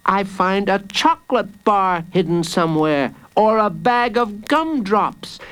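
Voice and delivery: put-on high-pitched child's voice; knowing child. Jacob reveals what he finds in his cell, knowing. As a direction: point it out like a confident child.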